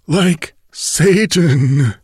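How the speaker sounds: very slow, fading last breath; dying words. Acted. Dying bandit's final words, very slow. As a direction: let the words die away.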